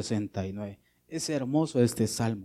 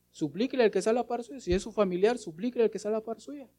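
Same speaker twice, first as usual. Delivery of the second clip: mock pleading; 9 semitones higher, faster than usual. Shown as a demonstration. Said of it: "¿Y cómo se suplica?".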